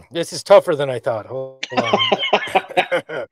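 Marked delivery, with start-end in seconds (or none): none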